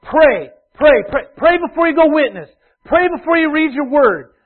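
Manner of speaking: loud, high preacher's exhortation; fiery preaching. Acted. Preacher urging the congregation in loud full-force preaching. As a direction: drive the point home to the church.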